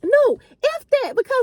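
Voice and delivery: high defiant refusal; defiant friend. Retold or imitated. Friend refusing to stop arguing, high defiant voice. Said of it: "she was like".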